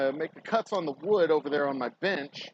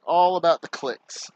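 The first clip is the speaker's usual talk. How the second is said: sarcastic; 5 semitones higher, 6 dB louder than usual. Pretend. Sarcastic about views.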